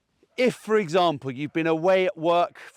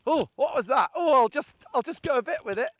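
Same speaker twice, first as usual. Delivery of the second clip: much higher curious dog voice; voicing the young dog; 10 semitones higher than usual. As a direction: play the pup, tempted and hesitant. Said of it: "This was a kind of".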